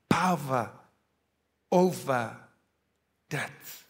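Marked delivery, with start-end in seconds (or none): none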